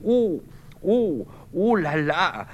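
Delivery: high, strained moans; groaning in pain. Acted. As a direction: moan as you slump down. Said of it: "Bernard qui s'affaisse sur une chaise". (gémissements de douleur)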